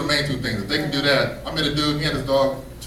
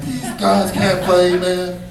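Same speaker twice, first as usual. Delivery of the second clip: put-on old athlete complaining, a touch louder; grumpy retired athlete; 3 semitones higher, 4 dB louder than usual. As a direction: gripe like a washed-up ex-player.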